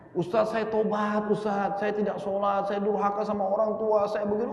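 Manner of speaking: imitated tearful plea, pitched high; trembling tearful confession. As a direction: act out a man sobbing his repentance into the mic.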